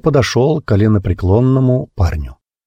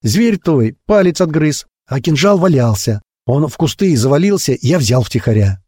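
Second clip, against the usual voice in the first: lightly voiced high, frantic boy; squealing; 7 semitones higher than usual. A narrator's reading. Boy confessing he took the dagger, mildly squealing. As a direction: gabble the excuse high, easing down at the end. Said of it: "заверещал паренёк".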